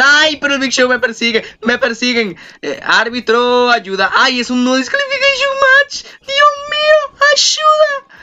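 high panicked cries; shrill cries for help; being chased. Real, not acted. Big Show chasing him in game.

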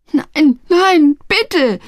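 high, loud begging; panicked lad pleading for mercy; terrified cry. A narrator's reading. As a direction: cry out before the blow lands. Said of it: "schrie Ernie Blackett".

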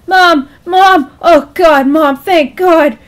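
loud, high, relieved cries; giddy, desperate relief; giddy hope. Acted. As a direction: call out to mom, overjoyed she answered. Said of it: "Calvin's voice was giddy with hope now".

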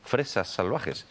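"fresas salvajes" spoken slowly with crisp hissed s sounds; careful clear s. Shown as a demonstration. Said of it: "ya habla así".

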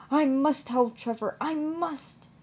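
urgent resolve. A narrator's reading. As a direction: press it urgently, pitch climbing. Edward resolves urgently to help.

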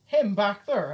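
insisting loudly; mock insistent shout. Friend insists, pointing back.

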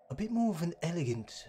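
prim, elegant butler voice; hoity-toity gentleman. Shown as a demonstration. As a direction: speak like a posh gentleman. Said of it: "a little bit hoity-toity".

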